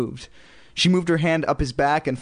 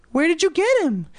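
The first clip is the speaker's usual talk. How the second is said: put-on very high little kid voice; high-pitched curious kid; child's voice. A narrator's reading. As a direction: ask it high like a small child. Child character's question voiced.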